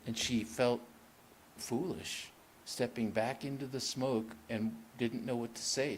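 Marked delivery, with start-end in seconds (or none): none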